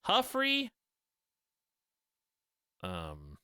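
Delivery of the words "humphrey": high trying out the name. Shown as a demonstration. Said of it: "'Cause it's all Ruffin".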